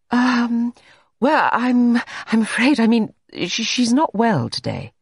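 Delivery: high, flustered, halting excuse; nervous faltering. A narrator's reading. Jane makes excuses to Everard for the maid, flustered and high. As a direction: stammer out an awkward cover story.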